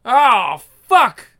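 a sudden pained shout; shocked yell. Acted. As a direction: cry out at the crash. Crash, shocked cry.

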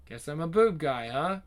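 ordinary delivery

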